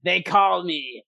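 cackling trickster fairy, gleeful; a mischievous fairy laughing as he rams her leg. Acted. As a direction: laugh out loud, then announce yourself. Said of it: "he just laughs out loud".

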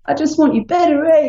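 high, playful New Zealand accent imitating her husband; Kiwi accent impression. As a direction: mimic a Kiwi husband, pitched well up. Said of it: "he's a Kiwi".

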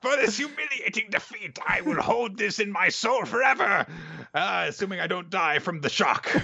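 very high, strained villain curse; hammed-up foiled villain shrieking in pain; sputtering foiled villain. Voicing the father's foiled curse.

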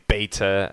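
"beta" as BAY-tuh; American pronunciation. Shown as a demonstration. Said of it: "Americans, you know, they always say".

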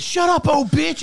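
high, loud imitated kid yell; kid's insult. Man mimicking the kid insulting the masked lady, high yell. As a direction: bark it like a boy who snapped. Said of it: "he says".

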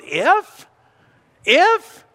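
high, rising disbelief on each if; incredulous echo. Retold or imitated. Jesus questioning the doubting father's if, incredulous. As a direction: throw the word back at him, amazed.

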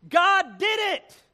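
high, loud, drawn-out praise shout; acted jubilant holler; repeated exclamation. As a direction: belt it slowly, heedless of the interruption. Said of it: "the lady repeated".

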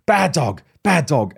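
sharp dog-owner telling-off; mock scolding. Owner to a dog that weed inside, mock scolding. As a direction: scold a naughty dog, lightly acted.

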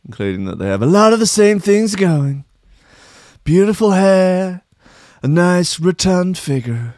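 mock Trump. Mocking Trump praising Boris, lightly voiced Trump. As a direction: ramble like a boastful Trump.

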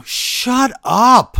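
loud, drawn-out "shut up"; emphatic outburst. Real, not acted. Interrupting co-host, mock outburst.

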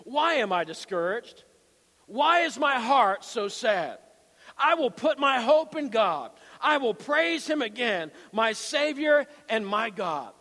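slow, emphatic psalm reading; preacher declaiming scripture. Acted. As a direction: build from the question into a loud declaration.

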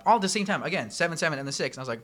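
ordinary delivery

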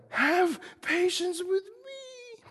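high, very slow begging; imploring plea. Acted. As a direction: beg for mercy, drawn out. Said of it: "he implores him".

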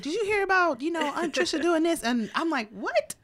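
dish the family gossip eagerly; gossiping relatives. Retold or imitated. Relatives trading news about Aunt Trisha, eager gossip. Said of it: "And it's like".

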